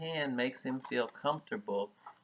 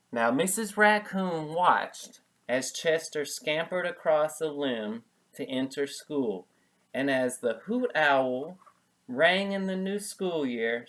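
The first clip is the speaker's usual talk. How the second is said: story narration; 2 semitones higher, 4 dB louder than usual. A narrator's reading. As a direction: read the ending to the children.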